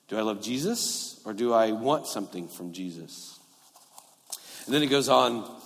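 ordinary talk

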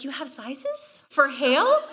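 slow, amused disbelief; drawn-out incredulous question; asking in slow amused disbelief. Retold or imitated.

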